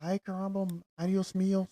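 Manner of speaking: mock Spanish. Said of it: "you're terrible".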